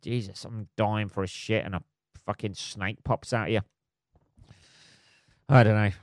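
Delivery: usual speaking voice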